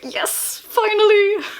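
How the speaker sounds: breathy yes, then high, loud finally; excited cheer. Real, not acted. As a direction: burst into a high, drawn-out squeal of joy. Celebrates a long-awaited moment.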